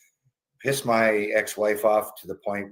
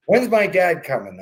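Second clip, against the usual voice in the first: impersonating mom; 7 semitones higher, 5 dB louder than usual. Retold or imitated. Mom with Alzheimer's asking.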